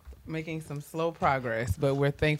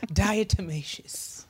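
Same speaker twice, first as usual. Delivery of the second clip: slow savoring; 3 semitones higher, slower than usual. Shown as a demonstration. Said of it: "Some words are just fun to say".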